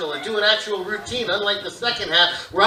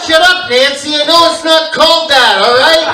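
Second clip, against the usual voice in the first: loud; 5 semitones higher, 8 dB louder than usual. Real, not acted. Snapping at heckler.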